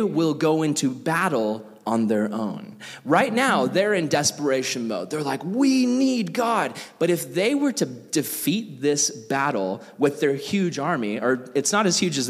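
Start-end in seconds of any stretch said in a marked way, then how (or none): 5.4-6.8 slow, higher pleading voice; desperate Israelites. Retold or imitated. Israelites in desperation mode beg, slow and high. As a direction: stretch it out like a desperate plea.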